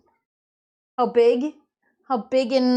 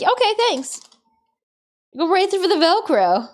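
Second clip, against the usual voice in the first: higher, smug little thank-you; pet's voice; 6 semitones higher than usual. Retold or imitated. Turtle casually thanking his owner, high smug voice. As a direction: voice the pet, breezy. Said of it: "That little monster is like".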